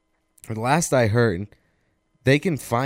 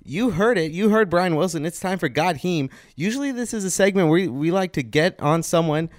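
higher upbeat announcer read; radio host voice; 5 semitones higher than usual. Acted. Host welcoming listeners back, upbeat announcer read. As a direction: keep the show rolling for listeners.

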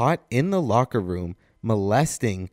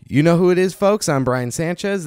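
higher, louder radio announcer hype; radio host intro; 6 semitones higher, 4 dB louder than usual. Acted. Sports radio host greeting his listeners, announcer hype. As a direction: pump up the listeners like a DJ.

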